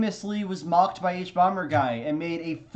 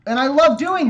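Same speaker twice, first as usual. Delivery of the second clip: gleeful; 9 semitones higher, 10 dB louder than usual. Real, not acted. Gleeful outburst.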